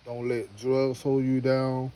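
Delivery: usual speaking voice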